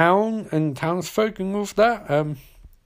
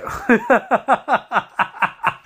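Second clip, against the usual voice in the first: real laughter; 4 semitones higher than usual. Real, not acted. Laughing at Loomis with a gun in the movie, real laughter. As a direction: burst out laughing.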